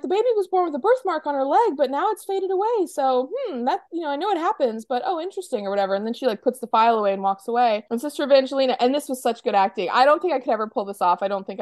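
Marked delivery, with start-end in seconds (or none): 0.0-5.7 higher, lightly voiced nun thinking aloud; curious nun musing. Trixie reads the baby book aloud, lightly voiced pondering. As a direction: muse over the record, half-puzzled. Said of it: "she's like".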